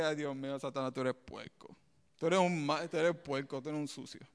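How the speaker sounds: half-laughing soft rebuke; mock scolding. Preacher retelling scolding Satan, soft and amused. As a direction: scold the devil under your breath, amused. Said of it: "yo dije".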